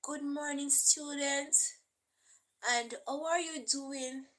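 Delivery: lightly voiced teacher, a touch proper and soft; a hint of a prim teacher greeting; proper English teacher. Teacher greeting the class in proper English, mild mock-proper. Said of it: "teacher will say".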